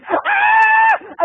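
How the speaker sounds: very high, long shriek; imitated scream. Girl behind as a car hits another car, piercing mock scream. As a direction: shriek as if suddenly terrified. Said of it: "again she screams".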